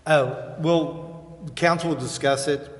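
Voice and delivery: ordinary talk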